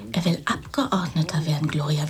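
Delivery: vertraulich geflüstert. Acted. Vertrauliches Gespräch mit Gloria.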